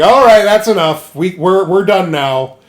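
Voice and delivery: loud, firm order to stop; mock commanding Casca. Casca halting the brawl, loud command. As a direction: call off the fight.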